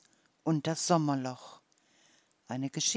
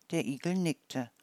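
ordinary delivery

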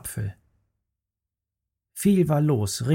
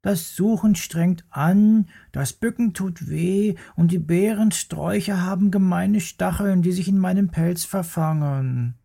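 grumbling little bear; 6 semitones higher than usual. Acted. Little bear complains to Mama about hard work, sulky. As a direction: moan like a tired little bear cub. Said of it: "maulte der kleine Bär".

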